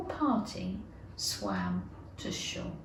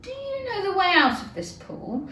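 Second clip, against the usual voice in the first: calling out; 6 semitones higher, 7 dB louder than usual. Acted. Alice calls out to mouse.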